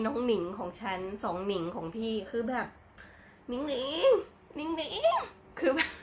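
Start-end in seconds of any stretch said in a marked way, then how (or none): 3.5-4.1 cutesy rising call. Shown as a demonstration. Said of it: "คำว่าหนิงหนิงไม่ใช่ชื่อ แต่มันคือจริต". 4.5-5.2 cutesy falling coo. Shown as a demonstration. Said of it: "คำว่าหนิงหนิงไม่ใช่ชื่อ แต่มันคือจริต".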